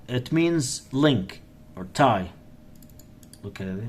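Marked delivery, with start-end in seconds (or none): none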